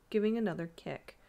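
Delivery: ordinary delivery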